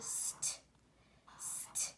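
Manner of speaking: breathy unvoiced st; soft whisper of the first sounds. Shown as a demonstration. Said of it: "sounding out stay".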